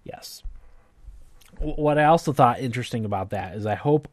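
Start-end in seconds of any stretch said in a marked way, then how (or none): 0.0-0.4 a breathy whispered yes. Real, not acted. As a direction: whisper it under your breath. Whispered aside.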